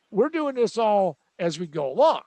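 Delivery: ordinary delivery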